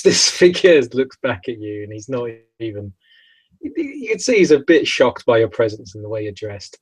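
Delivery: ordinary delivery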